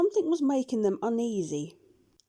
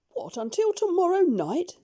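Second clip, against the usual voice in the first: surprised; 10 semitones higher, 4 dB louder than usual. A narrator's reading. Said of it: "said the doctor".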